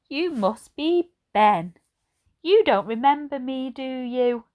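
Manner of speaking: put-on high, slow kindly old lady. A narrator's reading. Narrator voices old Edna greeting Ben, high and slow. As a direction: voice her like a gentle elderly lady. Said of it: "said the old lady".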